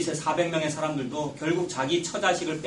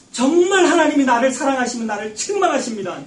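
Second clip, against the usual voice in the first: fervent preaching; 7 semitones higher, 6 dB louder than usual. Real, not acted. Preaching at full force.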